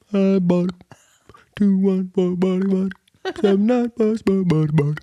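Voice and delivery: put-on high baby talk used on a grown-up; squeaky, doting doggy voice; puppy-greeting voice. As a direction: coo the digits as if to a puppy. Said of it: "trying to do it to just people".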